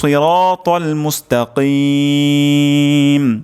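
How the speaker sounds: slow, drawn-out melodic recitation; Quran recitation. Shown as a demonstration. Teacher recites the full verse melodically. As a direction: chant the verse with long held vowels.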